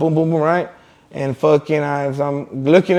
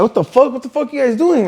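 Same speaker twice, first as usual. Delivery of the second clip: high-pitched, bewildered objection; retold alarmed protest; 8 semitones higher than usual. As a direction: protest as if suddenly grabbed. Retelling his protest during arrest.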